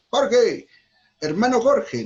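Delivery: loud, high call across the room; calling out a name. Shown as a demonstration. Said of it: "Podemos poner un ejemplo rápidamente". (llamando en voz alta)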